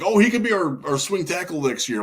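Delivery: high, eager fan voice; mock hype. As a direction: gush like an overexcited fan. Mocking overhyped fans.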